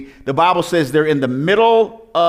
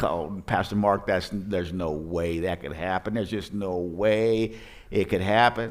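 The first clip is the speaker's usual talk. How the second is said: a hint of a mumbling skeptic, lower and softer; lightly voiced doubter brushing the miracle off, low and soft; mock skeptic telling Pastor Mark it could not happen, lower and softer; doubting listener; 9 semitones lower, 10 dB quieter than usual. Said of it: "Come on, that's like".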